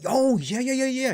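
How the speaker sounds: high oh yeah yeah; lightly voiced realizing the year; high, quick recognition; sudden recognition. Retold reply recognizing the date.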